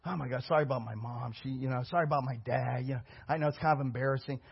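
embarrassed overachiever. Acted. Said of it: "They're like".

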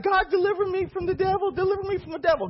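high, pleading mock prayer voice; mock whiny prayer. Mocking whiny believer's prayer.

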